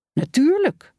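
plain reading voice

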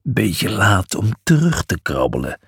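low, dry sneer; scornful. A narrator's reading. Miles mocking Julius for backing out, scornful. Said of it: "schamperde Miles".

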